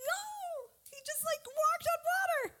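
very high, squeaky astonishment; amazed disbelief. Acted. Speaker marvels that Peter walked on water, high-pitched amazement. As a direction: start soft and squeal it in wonder.

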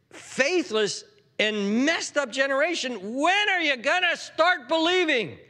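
exasperated, high, stretched rebuke rising to a demand; acted outburst of exasperation, pitched high; holy frustration. Jesus rebuking a faithless and messed up generation, frustrated. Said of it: "there's a holy frustration here".

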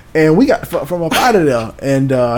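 usual speaking voice

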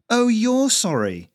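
high, loud, drawn-out sarcastic retort; snapped. A narrator's reading. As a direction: throw the apology back sharply. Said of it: "Lisa snapped".

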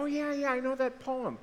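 high-pitched "oh yeah" of recognition; lightly acted, high-voiced recognition; mock recognition. Acts out listener recognising a poem.

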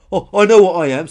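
loud, high huffy reply; indignant retort. Acted. Dinosaur is told she is covered in spikes, indignant. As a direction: retort loudly, offended. Offended dinosaur snaps back at Billy.

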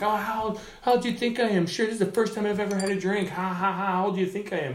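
high-pitched imitation of a drunk guy; drunk heckler. Drunk owner's kid answering the comic, mock high drunken voice. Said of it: "he's drunk, he's, like, ripped".

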